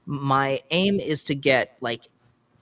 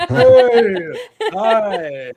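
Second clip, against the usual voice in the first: big loud high-pitched greeting; opposite of ASMR; 10 semitones higher, 11 dB louder than usual. Pretend. Guest mocks ASMR by doing the opposite, loudly. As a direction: blast out a hammed-up hello, laughing. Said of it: "Let's do the opposite of A S M R".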